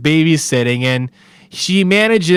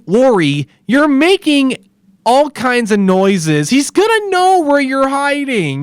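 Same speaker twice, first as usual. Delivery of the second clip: higher-pitched, pleading scold at a foolish character; exasperated scolding; 8 semitones higher than usual. Retold or imitated. Viewer scolding Lori on screen for panting while hiding, exasperated. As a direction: scold her like she can't hear you.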